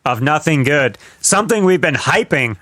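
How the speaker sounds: ordinary talk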